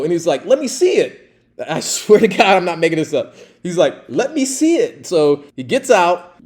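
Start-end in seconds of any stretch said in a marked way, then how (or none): 0.5-1.3 high, loud insistent request; eager demand. Retold or imitated. Acts colleague asking to see it. 4.1-5.1 high-pitched insistent request; eager demand. Retold or imitated. Repeats the colleague's request.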